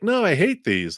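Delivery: put-on high, whiny little kid. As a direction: pout like a small child. Kid refuses donuts in story.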